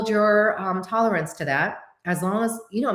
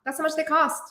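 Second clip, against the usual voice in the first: matter-of-fact reply; 8 semitones higher than usual. Retold or imitated. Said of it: "I'm like".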